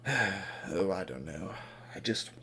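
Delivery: low, very soft sighing defeat; downcast. Acted. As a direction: mumble it as if to the ground. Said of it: "just looks at the ground".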